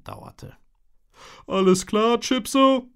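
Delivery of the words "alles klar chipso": put-on high, loud boy's voice; a boy calling a greeting; Toby's voice. A narrator's reading. (hohe, laute Jungenstimme)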